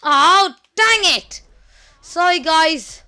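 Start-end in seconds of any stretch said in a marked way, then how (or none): 0.0-1.4 frustrated outburst. Real, not acted. Streamer gets hit in fight game.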